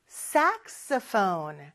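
"saxophone" starting high on SAX, then falling; slow model of "saxophone", SAX-o-phone; high-low intonation. Shown as a demonstration. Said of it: "이렇게 높낮이가 있어요".

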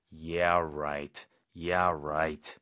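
low, drawn-out sarcasm; skeptical, stretched "right"; sarcastic. Shown as a demonstration. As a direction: drop the pitch and doubt them. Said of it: "약간 비꼬는 톤이죠".

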